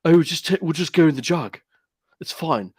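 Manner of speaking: mocking a toff who loves his Jag; Jacob Rees-Mogg. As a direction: ham up a posh Tory toff. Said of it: "You need to do the Jacob Rees-Mogg".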